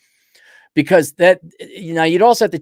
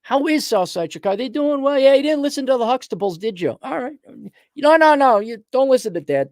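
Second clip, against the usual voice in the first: high-pitched taunting questions; mocking concern; 8 semitones higher than usual. Taunting those who didn't listen to Dad, high mocking voice. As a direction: needle them in a raised, sing-song pitch.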